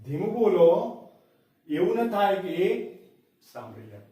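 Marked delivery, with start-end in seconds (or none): 3.4-4.0 suddenly hushed, much softer and lower; a sudden hush on સાંભળી જાય; dropped to a hush. Shown as a demonstration. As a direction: drop the voice as if someone might overhear. Example: speak softly lest he hear.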